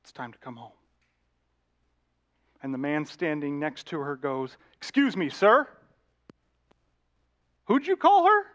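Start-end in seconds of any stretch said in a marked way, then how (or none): 0.0-0.7 low, hushed husband's voice; gentle plea. Retold or imitated. Husband calling his wife home, gentle plea. 4.8-8.5 high, loud challenge of a stranger; indignant challenge. Retold or imitated. Man confronting a woman's husband, indignant challenge.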